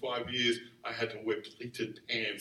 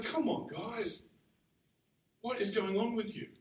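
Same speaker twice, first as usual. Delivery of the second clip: exasperated Paul; 7 semitones higher than usual. Retold or imitated. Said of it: "He's like".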